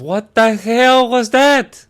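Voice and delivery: high, loud, drawn-out yell; mock outraged yell. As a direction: shout it slowly in exaggerated disbelief. Man outraged by the song.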